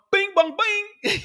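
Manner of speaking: very high, slow ding-dong; drawn-out chime. Pretend. Covid chime gag repeated.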